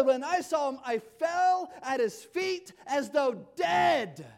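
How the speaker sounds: fervent shouted proclamation; loud preaching climax. Acted. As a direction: build it to a ringing peak.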